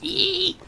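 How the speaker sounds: high mock squeak of a fighting hamster; tiny high squeal, laughing. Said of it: "is what you hear".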